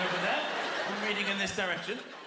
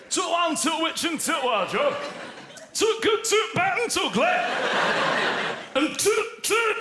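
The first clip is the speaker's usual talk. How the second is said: higher, louder Yorkshire toot for the; mock Yorkshire cashier; 9 semitones higher, 7 dB louder than usual. Leeds cinema cashier reading the film title in loud mock Yorkshire accent. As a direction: shout it in a hammed-up Yorkshire accent. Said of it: "vague Yorkshire accent".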